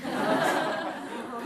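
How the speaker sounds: airy, hushed mock laugh; demonstrated laugh. Witnesses laughing among themselves as they pass by, mock laugh. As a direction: act out a short private snicker. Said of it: "요렇게 웃으면서".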